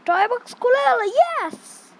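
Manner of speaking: loud, high cheer; excited triumph. Real, not acted. Gamer cheering as the toy box opens, excited. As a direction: celebrate the win out loud.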